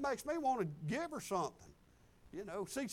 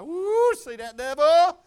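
loud, higher, slow jubilant cry; triumphant whoop; 6 semitones higher, 14 dB louder, slower than usual. Real, not acted. Preacher crowing at the devil in the parking lot, triumphant. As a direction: crow over the devil. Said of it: "triumphant shout".

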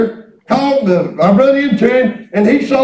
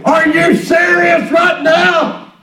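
drawn out and raised in disbelief; exasperated outburst; 7 semitones higher, much slower than usual. Retold or imitated. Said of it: "I wanted to say".

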